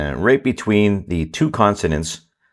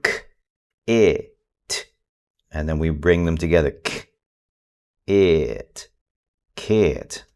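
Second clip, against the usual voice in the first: slow sounding out; 3 semitones lower, slower than usual. Shown as a demonstration. Parent coaching a stuck reader, slow and gentle. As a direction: sound out k and it slowly, then join them. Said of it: "Very slow".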